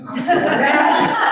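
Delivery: loud, drawn-out laughing sorry; laughing apology. Real, not acted. Amused at being asked to move.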